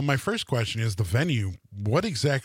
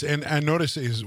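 normal talking voice

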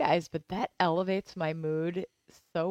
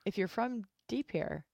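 usual speaking voice

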